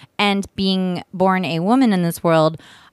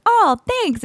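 high chirpy thanks; 13 semitones higher than usual. Retold or imitated. Retold polite reply, high.

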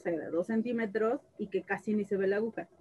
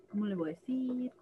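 soft, fretting to herself; worried murmur; 7 dB quieter than usual. Retold or imitated. Mother quietly frets about telling her son, softer. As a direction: murmur the worry under her breath. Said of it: "Entonces yo decía".

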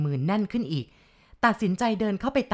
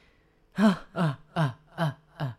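slow ghostly mocking chuckle; a drawn-out taunting ghost laugh; much slower than usual. Ghost laughing at the frightened chief, slow mocking cackle. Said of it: "เสียงหัวเราะเยาะ".

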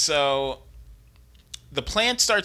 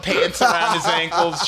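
ordinary delivery

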